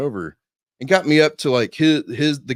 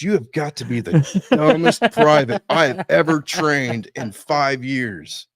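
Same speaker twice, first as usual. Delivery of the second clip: loud, higher sergeant's dressing-down, slowing at the end; sergeant's berating; 4 semitones higher, 7 dB louder, slower than usual. Retold or imitated. Drill sergeant berating his dumbest private, mock fury. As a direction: hammer out each word as an imitated drill sergeant.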